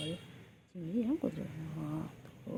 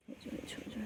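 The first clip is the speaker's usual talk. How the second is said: higher hushed whisper; 4 semitones higher, 10 dB quieter than usual. Real, not acted. Owner whispering to get the pet's attention, hushed. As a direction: whisper it softly and a little higher.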